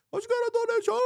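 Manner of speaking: sudden very high excited squeal; donation squeal on request. Shown as a demonstration. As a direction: squeal it like a donation just came in. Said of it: "So I gotta be like".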